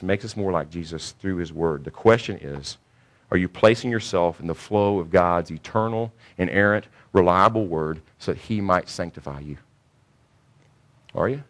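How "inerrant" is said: ordinary talk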